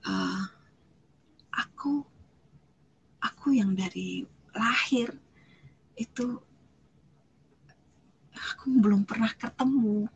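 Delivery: halting words with long pauses, choked up; acted tearful confession; tearful retelling. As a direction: imitate someone crying as they struggle to speak. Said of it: "Begitu dia nangis, dia bilang".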